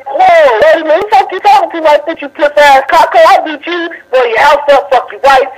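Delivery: high-pitched heated threats down the phone; angry threats. Real, not acted. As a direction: get worked up and threaten him.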